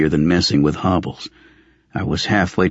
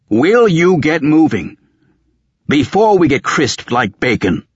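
loud, high-pitched urgent demand; exasperated command; 10 semitones higher, 4 dB louder than usual. Acted. Old man urging Raven to move before they burn, exasperated shout. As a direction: raise voice and pitch, drawing out the order.

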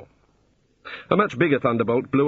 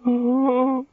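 tiny high-pitched squeak; 13 semitones higher than usual. A narrator's reading. Senior wizard shocked by the topic, squeaking. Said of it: "squeaked the senior wrangler".

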